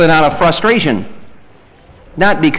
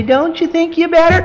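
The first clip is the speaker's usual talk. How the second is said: higher, meek coaxing; timid parent; 12 semitones higher than usual. Retold or imitated. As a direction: coax the child meekly. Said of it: "and we say".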